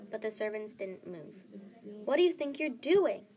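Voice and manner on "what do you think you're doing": loud, high-pitched angry outburst; furious yell. A narrator's reading. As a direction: yell at disobedient servants. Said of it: "she yelled".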